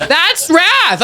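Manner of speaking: usual speaking voice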